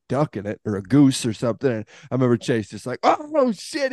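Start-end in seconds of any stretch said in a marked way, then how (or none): 3.0-3.9 imitating a friend's excited squeal, pitched much higher; a hunter spots ducks everywhere, squeaks up high with excitement; fired-up imitation. Mock excited yelp, much higher. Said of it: "He's, like, just fired up".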